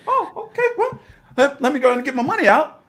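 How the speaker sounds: high-pitched, hurried mock acceptance; high mock reaction. Investor mimicking himself pulling his money out, high-pitched. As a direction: act it out way up high, quickly.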